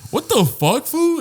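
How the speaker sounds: high-pitched, affronted cat; voiced cat. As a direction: put on a high voice for an offended pet. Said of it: "he's just like".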